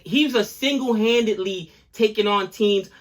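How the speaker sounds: ordinary talk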